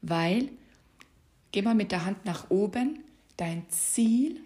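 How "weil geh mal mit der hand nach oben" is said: ordinary delivery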